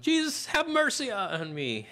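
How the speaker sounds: high, drawn-out cry, not at full volume; calling out loudly. Retold or imitated. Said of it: "you're talking loudly 'cause".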